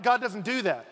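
ordinary delivery

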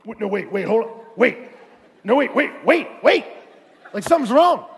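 loud, acted-out panicky stalling; hammed-up panic with urgent repeats; mock panic. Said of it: "they would just panic".

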